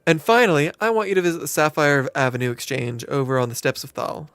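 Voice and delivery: lightly drawled southern landlady; a hint of a folksy southern innkeeper welcoming a newcomer; friendly southern twang, lightly done; southern accent. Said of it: "she went southern".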